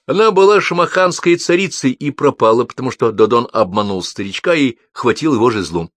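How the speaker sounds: high, loud start easing down; excited recounting. A narrator's reading. Мальчик рассказывает про шамаханскую царицу, excited recounting.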